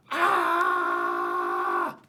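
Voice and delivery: loud low "Ah!" scream. Shown as a demonstration. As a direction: let out one big scream to scare the alligator.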